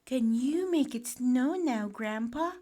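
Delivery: put-on high, loud, eager boy's request; pleading William. A narrator's reading. Boy asking Grandpa to make it snow, eager. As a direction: ask Grandpa with bursting hope. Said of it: "asked William".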